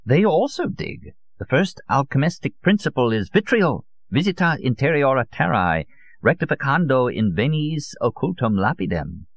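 put-on high, insistent old zealot. Old Solon reveals the alchemists' motto to his visitor, high-voiced zealot. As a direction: intone the Latin like a secret formula.

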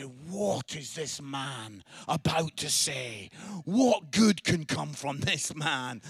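slow, low, portentous pronouncement; imagined grave onlookers. Retold or imitated. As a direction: intone it like a solemn prophecy. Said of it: "as if to say".

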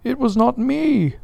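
high frightened protest; cried. Acted. The steward protests to Stubb, high and afraid. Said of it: "cried Doughboy".

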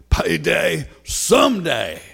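slow, booming "Payday someday." called high and loud; preacher's shout. Retold or imitated. Old Southern preacher calling out his sermon title, slow loud shout. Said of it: "he just calls out".

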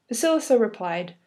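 usual speaking voice

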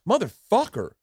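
high, stretched-out curse of awe; mock amazement. Comic realizing his friend found two jokes, amazed. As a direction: let the curse soar as it dawns on you.

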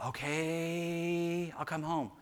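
soft, drawn-out frog reply; a hint of a resigned husband frog, quietly; lightly voiced frog. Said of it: "What is he saying?".

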